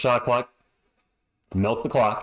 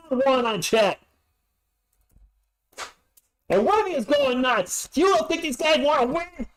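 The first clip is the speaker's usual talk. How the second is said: way higher, hyped call; excited yell; 10 semitones higher than usual. Real, not acted. Fan calling the game-winning dunk, hyped yelling. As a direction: ride the hype of the closing dunk. Game-sealing plays at the end.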